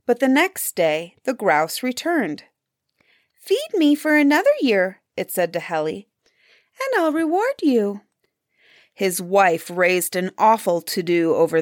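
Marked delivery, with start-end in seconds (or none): 3.3-5.0 high, piping bird voice; talking grouse. A narrator's reading. Returned grouse asks to be fed. 6.8-8.0 high, piping bird voice; talking grouse. A narrator's reading. Grouse makes the hunter a promise.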